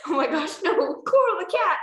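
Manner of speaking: high, laughing outburst; laughing exclamation. Real, not acted. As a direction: get the words out through giggles. Cracking up at the story.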